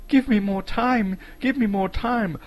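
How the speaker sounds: high, loud desperate shout. A narrator's reading. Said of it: "He wanted to shout".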